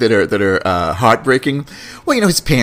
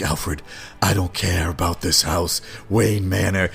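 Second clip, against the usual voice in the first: low-pitched brooding hero impression; 5 semitones lower, 5 dB quieter than usual. Bruce Wayne dismissing the manor to his butler, low impression. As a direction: drop low, dismissive, softer. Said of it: "And he's like".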